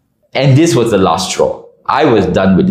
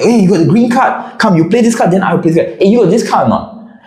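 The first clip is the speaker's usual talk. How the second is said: higher, bossy mimic of Y; pitched-up impression of an alpha gamer; imitating Y; 9 semitones higher than usual. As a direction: voice him as a pushy know-it-all. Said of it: "and he's like".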